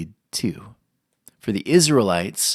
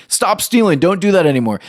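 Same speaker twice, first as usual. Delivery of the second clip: much higher and louder, hammed-up "Stop stealing"; mock scolding shout; 7 semitones higher, 5 dB louder than usual. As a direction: bark "Stop stealing" at a child in a mock yell. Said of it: "that's not very compelling".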